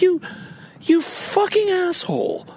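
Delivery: angry retold insult aimed at the driver; angry curse. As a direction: curse the driver all over again. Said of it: "I was so pissed off".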